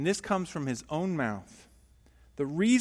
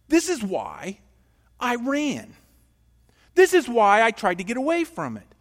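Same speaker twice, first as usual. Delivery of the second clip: higher, louder, exasperated protest to God; angry complaint; 6 semitones higher, 7 dB louder than usual. Acted. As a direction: complain bitterly to God like resentful Jonah. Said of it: "Can you just hear him right now?".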